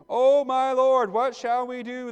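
loud, high, drawn-out cry of alarm; panicked cry. A narrator's reading. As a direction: read it as a servant calling out in dread. Frightened servant's cry in scripture reading.

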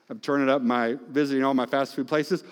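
ordinary delivery

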